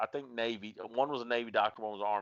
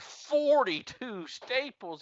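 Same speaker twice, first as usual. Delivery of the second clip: high, drawn-out disbelieving question; incredulous, slow and high; shocked disbelief; 13 semitones higher, 4 dB louder, much slower than usual. Retold or imitated. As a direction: stretch it out in disbelief, much higher. Retelling shocked reaction to count.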